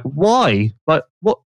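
mildly baffled, rising at the end; halting mock bewilderment; retold dumbfounded. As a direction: imitate a confused rider questioning the team. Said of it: "he was just dumbfounded".

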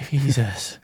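normal talking voice

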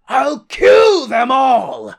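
loud, high, drawn-out villain roar; over-the-top vengeful shout; villainous shout. Acted. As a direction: bellow it like a vengeful maniac. Acting Earl's murderous vow in recap.

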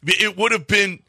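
retold exasperated high-pitched disbelief; incredulous outburst. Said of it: "And Carlos is like".

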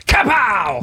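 high, falling battle cry; mock shout. Imitating the journalist punching a director's stomach, high mock shout. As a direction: yell it like landing a punch. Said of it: "og råbte".

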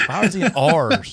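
slow, teasing "R's" in place of hours; mock Southern pronunciation. Host ribbing guest over his accent, mock Southern pronunciation. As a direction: stretch "R's" as a mispronounced "hours". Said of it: "you're working on that Southern accent".

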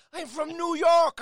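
high, slow shouted Irish brogue; Irish accent. Retold or imitated. Passenger insisting to doubting cab driver, shouted Irish brogue. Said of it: "I'm talking as if, as if I'm from Ireland".

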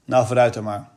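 ordinary delivery, nothing marked

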